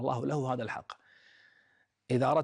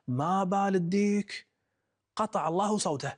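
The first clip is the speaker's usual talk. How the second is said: higher, slow exasperated curse; vexed outburst; 8 semitones higher, 4 dB louder than usual. Retold or imitated. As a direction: let his frustration spill out. Saeed vexed at silent rooster.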